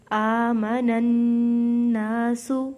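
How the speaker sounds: slow, loud melodic tajweed chant; Quran recitation. Acted. Teacher recites for students in slow chant. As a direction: draw every syllable out like a reciter.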